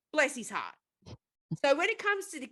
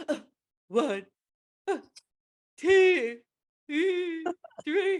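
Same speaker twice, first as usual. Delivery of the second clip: hammed-up higher moans between numbers; mock-moaning count, louder peaks; 6 semitones higher, 4 dB louder, much slower than usual. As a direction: ham up her pained little moans. Mimics woman moaning through spanking.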